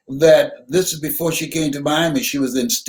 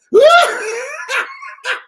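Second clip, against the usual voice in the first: a real, high-pitched laugh bursting out; high burst of laughter; 4 dB louder than usual. Real, not acted. Audience hears the grits punchline, he bursts out laughing high. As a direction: let the laugh explode up high.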